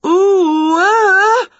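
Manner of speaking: high, loud drawn-out wail. A narrator's reading. Winnie as the dresser begins to tip, wailing. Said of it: "wailed Winnie".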